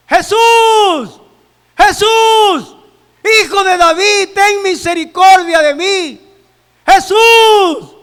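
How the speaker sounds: high, very loud desperate shout; heartrending cry. Acted. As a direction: cry out for mercy with drawn-out calls. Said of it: "a gritar desgarradoramente".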